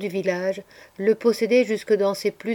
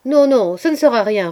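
loud, raised insistent denial; firm reassurance; 4 semitones higher, 8 dB louder than usual. A narrator's reading. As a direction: brush the worry away forcefully. Narrator reassures the frightened girls.

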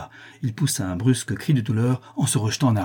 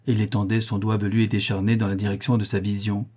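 usual speaking voice

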